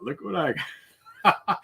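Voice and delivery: teasing. Retold or imitated. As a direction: rub it in, rising gleefully. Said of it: "I teased him".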